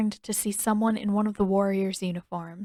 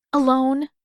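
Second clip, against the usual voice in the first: pointed; 5 semitones higher, 7 dB louder than usual. Acted. Katara pointed at Coco.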